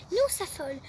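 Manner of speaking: usual speaking voice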